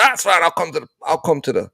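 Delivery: high-pitched, agitated quoted retort; mock curt officer. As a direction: act out an irritated officer giving in. Acted officer reply in argument.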